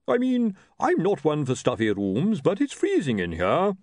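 high, complaining character voice; blustering chief priest. Acted. Hunon complaining to Lord Vetinari of the cold, blustering.

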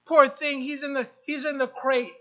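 usual speaking voice

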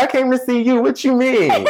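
very high-pitched mock indignation; playful protest. Regular answering his waitress, high playful protest. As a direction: imitate a regular teasing back in a high voice.

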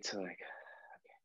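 whispered, trailing off into calm; relaxed whisper. Retold or imitated. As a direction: breathe the okay out softly. Relaxed patient's calmer state.

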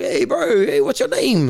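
high-pitched mock chaplain, calling out the greeting; chaplain impression. Former inmate imitating the prison chaplain greeting him, high put-on voice.

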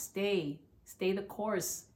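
ordinary talk

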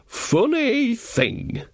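high, very slow, drawn-out musing; mock dreamy musing. Nanny Ogg recalling her thought, high slow dreamy musing. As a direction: float the words up high and stretch them out. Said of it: "said Nanny Ogg".

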